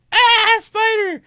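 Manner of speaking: put-on very high, slow, loud child shriek; scared child. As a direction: shriek like a frightened little girl. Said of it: "she's like".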